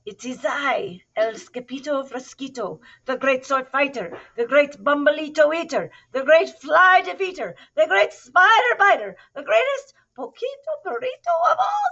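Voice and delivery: proclamation building to a high shout; grand boasting. A narrator's reading. Kitten announcing himself as the great hero, grandly building.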